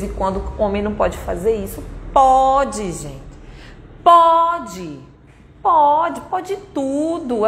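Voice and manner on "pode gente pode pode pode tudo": emphatic. Real, not acted. Emphatic permission.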